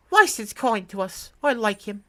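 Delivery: put-on high little kid voice; child's voice. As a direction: voice a fond small child.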